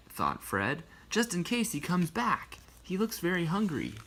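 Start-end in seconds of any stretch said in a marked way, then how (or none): 1.1-3.9 put-on higher young boy voice; lightly raised boy voice; boy's voice. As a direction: voice Fred as a little boy. Fred's thoughts voiced.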